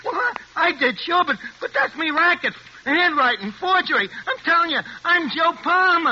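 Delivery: high, stammering and frantic; desperate protest. Acted. Crook insists on his identity to the agent, desperate. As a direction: plead your real name.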